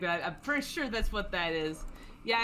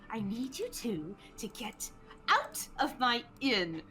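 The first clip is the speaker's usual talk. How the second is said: higher, firm scolding; stern innkeeper glare; 4 semitones higher than usual. Acted. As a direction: order them out with strained patience. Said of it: "shoots a glance at this Kappa".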